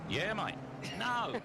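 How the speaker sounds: exaggerated Aussie, leaping up high on the last word; attempted Australian accent. Host jokingly answering his co-commentator, mock Australian accent. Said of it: "do my Australian accent".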